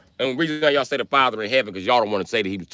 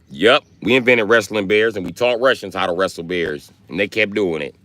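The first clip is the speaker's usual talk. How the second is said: usual speaking voice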